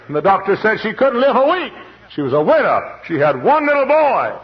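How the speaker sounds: high, loud preaching climax; fervent preaching. Acted. Preacher telling of the dying widow, high and loud. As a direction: cry it out to the congregation.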